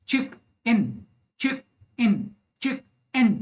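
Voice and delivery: "चिक इन" broken into two separate beats; syllable by syllable. Shown as a demonstration. Teacher counting two syllables for learners, syllable by syllable. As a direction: pause between "चिक" and "इन", each syllable distinct. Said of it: "इसमें दो भी syllable है".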